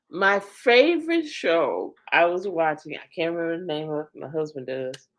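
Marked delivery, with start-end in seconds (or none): none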